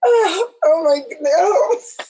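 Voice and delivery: slow, tearful gasp of emotion; overcome, voice catching near tears; moved to tears. Real, not acted. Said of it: "I'm almost in tears".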